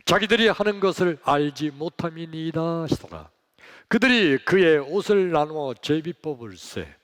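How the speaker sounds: loud, brisk congregational reading; scripture reading aloud. Acted. Pastor leading online congregation in loud Bible reading. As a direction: read the verses out in full voice.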